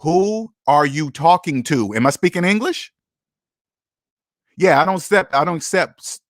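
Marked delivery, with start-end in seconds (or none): 0.0-2.9 slow and measured, each word spelled out; slow deliberate challenge. Retold or imitated. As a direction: look them in the eye and take your time. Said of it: "You're gonna say".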